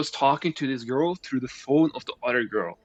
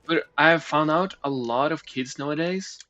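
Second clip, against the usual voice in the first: normal talking voice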